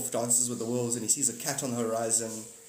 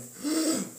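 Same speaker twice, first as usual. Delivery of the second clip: mock gasp. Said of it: "I was like".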